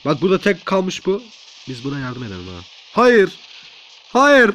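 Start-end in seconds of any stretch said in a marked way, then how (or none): 2.9-4.5 higher, loud game-panic cry; mock panicked refusal. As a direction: cry out as if begging for your life. Pleading not to be killed.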